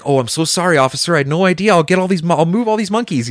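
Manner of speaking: high-pitched, hurried-to-please retold apology; eager mock contrition; apologetic. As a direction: imitate yourself placating an officer.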